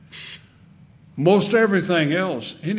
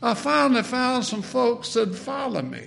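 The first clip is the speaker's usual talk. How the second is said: high nasal voice; pitched-up nasal quote, held high; R G Lee; 8 semitones higher than usual. Retold or imitated. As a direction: imitate an old preacher, high and nasal. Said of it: "in that high nasal voice".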